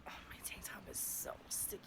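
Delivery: unvoiced whispered aside about the heat; muttered aside. Real, not acted. Complaining to herself about the sticky heat, whispered. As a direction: whisper it to yourself, sticky and hot. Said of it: "So hot here, sorry".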